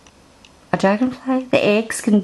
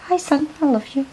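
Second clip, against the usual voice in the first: high, soft cooing to a pet; cooing to cat; 6 semitones higher, 3 dB quieter, faster than usual. Real, not acted. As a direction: coo lovingly at the cat.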